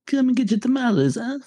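high-pitched, hammed-up Italian accent; mock Italian accent. Joking about a mob front's tamale seller, mock Italian accent. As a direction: play an Italian mobster hawking food in a put-on accent. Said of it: "would have an Italian accent".

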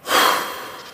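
long deep breath, acted out. Told to breathe and be calm, acting out a deep breath. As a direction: re-enact one big calming breath. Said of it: "And I took this".

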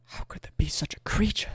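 hushed mock-poetic marvelling; awestruck whisper. Recalling his first thought on seeing her, awestruck whisper. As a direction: whisper it like a smitten suitor. Acts awestruck wooing of silky woman.

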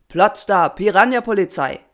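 loud commanding rallying cry; calling out. A narrator's reading. Patron opens the assembly, loud commanding call. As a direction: call the motto out to everyone. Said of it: "rief er".

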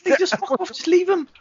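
high, loud laughing glee; excited high outburst. Real, not acted. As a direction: burst out in disbelief.

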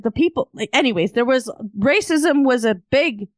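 ordinary delivery

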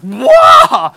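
high, booming drawn-out whoa; retold roar over the other person; loud outburst. Husband raging at wife on the train, loud outburst. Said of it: "And he's like".

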